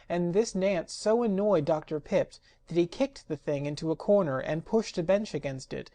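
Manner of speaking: plain reading voice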